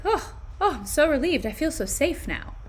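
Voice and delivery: a teasing imitation of feeling safe; mock relief. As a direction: play up the relief. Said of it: "Do you feel like".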